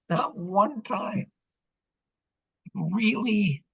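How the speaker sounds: high, drawn-out mock incredulity; playful, high-pitched incredulity; mock disbelief. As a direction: stretch it out high, as if unable to believe it. Mock disbelief at failed tool.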